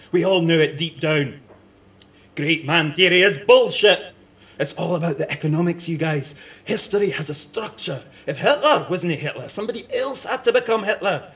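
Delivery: high, loud, fast lecturing rant with laughs; gleeful know-it-all rant; excited rant. Acted. Bell ranting excitedly about history.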